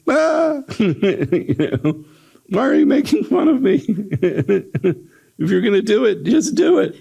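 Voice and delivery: high, frantic begging with a sob in it; terrified pleading. Acted. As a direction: beg to be killed, near tears.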